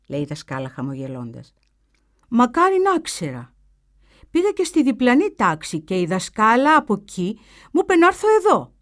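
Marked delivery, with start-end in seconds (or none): 2.3-8.7 put-on higher, louder boy's voice; voiced boy. A narrator's reading. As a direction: play the lost new pupil, eager. Boy explains to the teacher.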